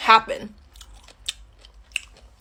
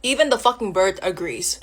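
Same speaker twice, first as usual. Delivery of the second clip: ordinary talk, nothing marked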